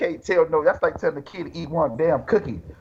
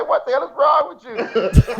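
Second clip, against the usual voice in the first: laughing mock outrage; 11 semitones higher, 3 dB louder, slower than usual. Retold reaction, laughing high.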